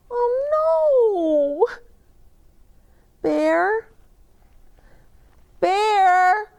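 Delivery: put-on high, loud worried child calling; calling for Bear. Boy searching the woods for Bear, high worried calls. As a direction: call out searching for a lost friend.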